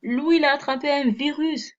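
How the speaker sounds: hammed-up high squeal of disbelief; mock shock. Reprise moqueuse après un « Ouh ». (fausse stupeur, voix aiguë et forte)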